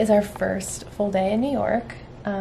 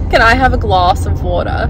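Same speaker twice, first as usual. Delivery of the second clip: Australian accent on "can I have a glass of water?", broad "glass", r dropped in "water"; an Aussie asking for a glass of water; 7 dB louder than usual. Shown as a demonstration. [name]'s turn in the accent contest, Australian accent. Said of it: "this is my impression".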